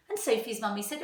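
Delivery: lively, expressive storytime voice; animated picture-book reading; reading to children. A narrator's reading. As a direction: read it aloud to a class of young children. Teacher reads story to class.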